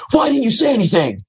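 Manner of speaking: high mock yell of an upset producer; imitating a friend yelling at him; retold yelling. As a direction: throw it up high like she's yelling. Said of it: "she's literally yelling at me".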